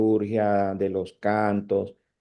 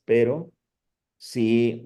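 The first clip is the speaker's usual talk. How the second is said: ordinary talk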